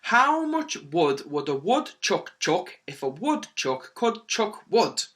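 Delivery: slow, deliberate, separated words; slow tongue twister. Shown as a demonstration. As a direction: say the tongue twister slowly and carefully. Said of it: "I'll say it slowly".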